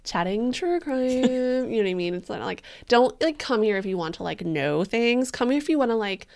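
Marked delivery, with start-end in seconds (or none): none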